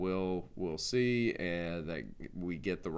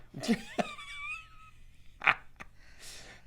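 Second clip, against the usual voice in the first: high-pitched burst of laughter; real laugh. Real, not acted. Host cracking up at his own Guardians of the Galaxy joke, high laugh.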